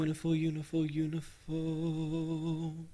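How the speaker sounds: soft, slow self-soothing chant; calming mantra. Acted. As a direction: breathe the word to calm down.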